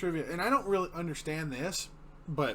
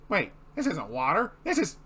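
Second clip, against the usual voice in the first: rising alarm; 2 semitones higher, 6 dB louder, slower than usual. Retold or imitated. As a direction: pitch climbs into alarm. Squidward realizing gas.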